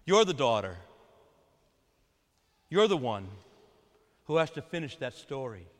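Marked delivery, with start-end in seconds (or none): none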